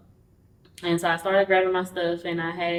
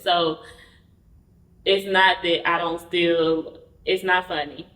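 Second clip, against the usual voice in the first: ordinary delivery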